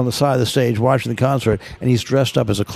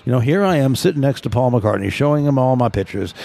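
ordinary delivery

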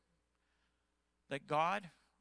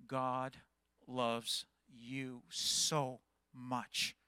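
tender; 4 semitones lower, much slower than usual. Real, not acted. Pastor addresses someone he nearly lost.

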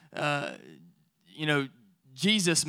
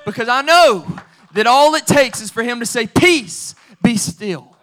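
louder, higher, rising preacher fervor; full-force preaching; 5 semitones higher, 8 dB louder than usual. Acted. Pastor assuring the church Jesus is in the boat, fervent preaching. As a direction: build it up to the congregation.

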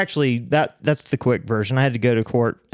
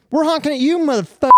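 mock angry yell; 13 semitones higher, faster than usual. Angry driver quoted.

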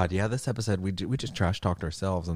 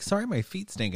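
much higher, prissy apology; hammed-up sheepish sorry; mock apologetic friend; 12 semitones higher, 4 dB quieter than usual. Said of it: "But it was like".

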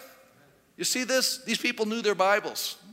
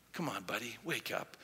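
gentle nudge; 11 semitones lower, 15 dB quieter than usual. Retold or imitated. Captain gently waking Jonah, rejected version.

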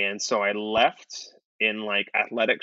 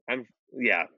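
usual speaking voice

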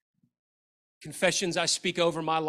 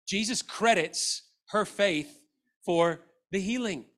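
normal talking voice

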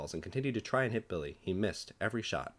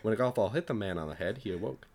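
ordinary delivery

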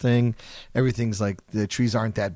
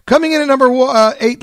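much higher and louder countdown call; countdown hype; 13 semitones higher, 8 dB louder than usual. Real, not acted. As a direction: announce the chart spot with a big build. DJ announces countdown position.

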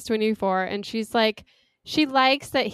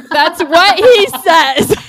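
laughing; 8 semitones higher, 13 dB louder, slower than usual. Real, not acted. Laughing at a quote.

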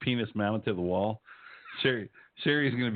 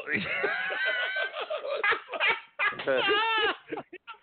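shrieking laugh. Real, not acted. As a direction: howl with laughter. Bursts out after a crude joke.

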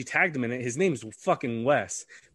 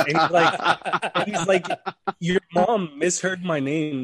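amused, laughing as you tell it; cracking up mid-story; laughing retelling; 5 semitones higher, 5 dB louder than usual. Real, not acted. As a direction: talk through real laughter, louder and higher at first. Laughing while retelling the mix-up.

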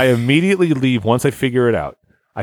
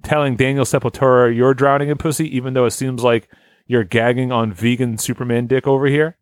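ordinary talk, nothing marked

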